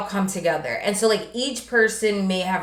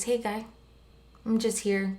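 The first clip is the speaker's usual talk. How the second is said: much softer imitated character voice; soft-spoken sweater; 3 semitones higher, 8 dB quieter than usual. Grieving sweater introducing itself to the son, really softly. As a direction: play it hushed and unassuming. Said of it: "He talks really softly like this".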